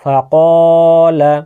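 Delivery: ক held long between short ফা and লা; drawn-out vowel. Shown as a demonstration. Teacher demonstrating one alif length, ক drawn out. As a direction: stretch the ক, keep ফা and লা short. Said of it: "টানতে হবে।".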